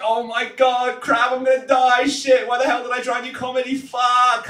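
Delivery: mock panic. Comic on a crashing plane, mock terrified yelling. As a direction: shout it like the plane is going down. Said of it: "I've been like".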